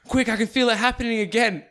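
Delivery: hammed-up fake medical alarm; mock panic. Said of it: "he was like".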